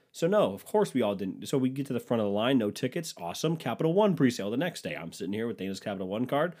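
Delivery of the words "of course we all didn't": ordinary talk, nothing marked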